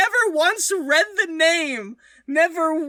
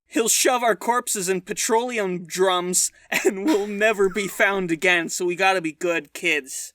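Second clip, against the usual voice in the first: low, deep serious voice; 9 semitones lower than usual. Acted. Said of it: "we'll be regular boys".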